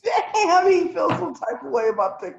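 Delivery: surprised. Real, not acted. Reacting to his TikTok rant.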